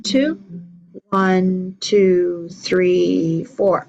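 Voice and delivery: steady counting the beat aloud; counting along. Shown as a demonstration. Teacher counting rhythm while the class claps. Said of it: "let's clap".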